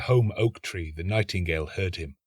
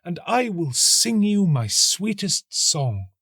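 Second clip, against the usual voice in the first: a little higher, earnest plea; pleading nightingale; 9 semitones higher, 4 dB louder, slower than usual. A narrator's reading. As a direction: beg the tree earnestly. Nightingale offers song.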